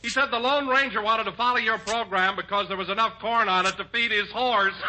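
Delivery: normal talking voice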